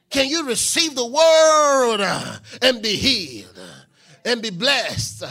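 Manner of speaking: loud challenge, highest on "word"; full-force preaching. Real, not acted. Preacher challenging the congregation to receive healing, shouting. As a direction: call the room to receive it.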